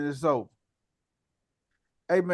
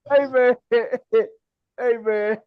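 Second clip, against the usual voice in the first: laughing, higher and louder; 6 semitones higher, 4 dB louder than usual. Real, not acted. Host cracks up after the clip, laughing loud. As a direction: burst out laughing between words.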